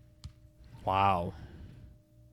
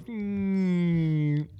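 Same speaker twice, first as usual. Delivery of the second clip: high buzzing hum; bee buzz imitation; 7 semitones higher, 5 dB quieter than usual. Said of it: "this bee goes".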